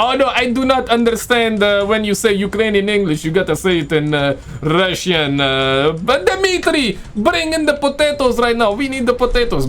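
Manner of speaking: hammed-up Russian accent, climbing high on the orders. Reactor joking as a Russian ordering Dmitry about, Russian accent. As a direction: put on a playful high-pitched Russian accent. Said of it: "I love the Russian accent".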